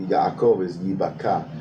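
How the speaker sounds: normal talking voice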